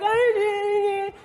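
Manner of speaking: high, wailing mock sob; hammed-up incoherent crying; hysterical crying imitation. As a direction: blubber as if the words won't come. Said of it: "I start crying hysterically".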